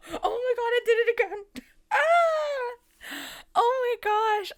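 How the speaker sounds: high, loud laughing through the words; squealing with real delight; delighted laughter. Real, not acted. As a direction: burst out laughing in amazed delight. Card pull matches her thoughts.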